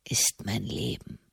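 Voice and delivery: breathless whisper, very low. A narrator's reading. Prisoner whispers.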